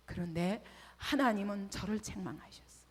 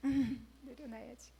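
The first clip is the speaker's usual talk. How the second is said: reluctant resolve. Retold or imitated. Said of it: "근데 참 힘들더라고요".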